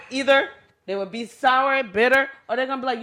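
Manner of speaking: ordinary delivery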